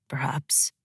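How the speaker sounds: low, very soft concession; careful. A narrator's reading. Father conceding a point to his daughter, careful. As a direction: drop it low and quiet, guarded. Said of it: "choosing his words carefully".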